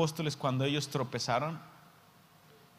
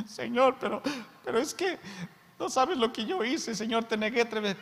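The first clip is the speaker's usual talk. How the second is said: higher, whining protest; pleading Peter; 7 semitones higher than usual. Acted. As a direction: beg like a guilty disciple.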